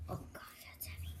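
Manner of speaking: hushed aside under the breath; whispering. Real, not acted. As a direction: whisper it to yourself. Lifting heavy bottles, aside.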